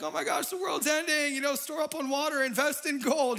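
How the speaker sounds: freaking out Squidward. Retold or imitated. Retelling SpongeBob scene, Squidward in high mock panic. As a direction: ham up the end-of-the-world fear. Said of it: "He's, like, freaking out".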